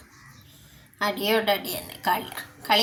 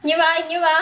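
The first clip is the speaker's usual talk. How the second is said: coaxing call; 6 semitones higher, 5 dB louder than usual. Real, not acted. Coaxing cats to come in.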